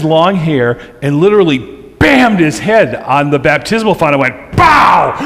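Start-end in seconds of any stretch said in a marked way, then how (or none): 4.6-5.1 higher, louder "Bow!" for the knock; imitated loud crack. Pastor retelling the baby's head bumping the font, loud imitated crack. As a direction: bang it out like a head hitting stone.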